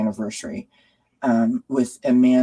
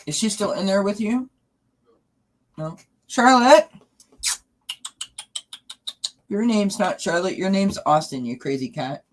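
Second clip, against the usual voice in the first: much higher coaxing voice for the cats; pet talk; 9 semitones higher than usual. Real, not acted. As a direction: sweet-talk the cat to come up. Coaxing cats onto desk.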